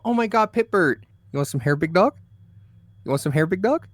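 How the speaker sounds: high cooing pet voice; baby talk to cat. Retold or imitated. Teasing her cat with hair, high cooing voice. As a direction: coo at the cat like a puppy. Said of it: "As a joke, sometimes I go".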